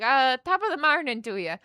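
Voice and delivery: high, loud Irish brogue; Irish accent. Retold or imitated. Repeating the hallway audition for the director, Irish accent. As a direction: throw out a hammed-up Irish greeting. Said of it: "I just said that to her just like that".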